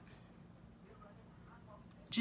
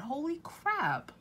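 much higher, slow drawn-out dismay; real dismayed holy crap; dismayed exclamation; 11 semitones higher, slower than usual. Real, not acted. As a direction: wail it in disbelief. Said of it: "I'm just really mad".

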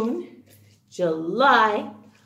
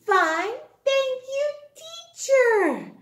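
put-on child voice; 12 semitones higher than usual. Children answer in chorus.

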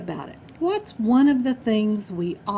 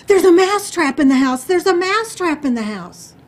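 high, loud panicked cry; shrieked warning, acted out; horrified mouse; 7 semitones higher, 10 dB louder than usual. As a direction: shout the alarm like a terrified storybook mouse. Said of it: "The mouse is horrified".

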